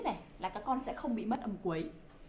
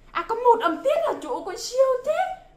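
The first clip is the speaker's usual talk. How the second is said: high, louder amazed cheer; gushing praise; 11 semitones higher, 5 dB louder than usual. Pretend. Teacher echoing pupil's answer about syllables, excited high praise. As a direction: marvel at a child's right answer, pitched way up.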